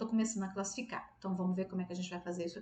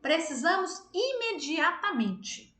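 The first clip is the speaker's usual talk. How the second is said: higher, slow and emphatic; urgent demand; 6 semitones higher, 4 dB louder, slower than usual. Shown as a demonstration. Teacher demos to the class an urgent need with no object, slowly. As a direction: stress imediatamente slowly. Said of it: "ou então eu falo assim, ó".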